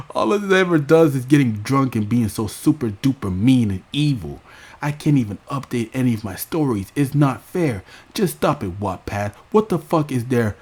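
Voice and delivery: high, loud mock tantrum voice; imitating Raven's tantrum. Raven mocked, yelling at her producers about YouTube. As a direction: throw a hammed-up fit at the producers. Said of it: "she will take it out on the producers".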